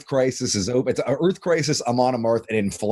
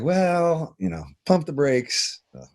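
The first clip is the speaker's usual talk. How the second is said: slow, higher, patronizing put-on voice; condescending label; 5 semitones higher than usual. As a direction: talk down to them like a cagey exec. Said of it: "everybody's like".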